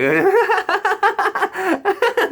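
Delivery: high shrieking fit of laughter, way up; wild laughter. Retold or imitated. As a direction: cackle like a lunatic in the grass. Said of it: "And I just started fucking laughing".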